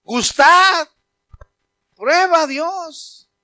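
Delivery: high, loud, slow "Gustad, prueba a Dios"; fervent invitation. Acted. Preacher urging unbelievers to taste God, fervent. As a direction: plead with them to taste God.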